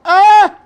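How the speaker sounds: high loud sudden ah; sharp shocked cry. Real, not acted. Host realising the stakes, high loud shocked cry. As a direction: yelp in dismay. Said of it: "shocked cry".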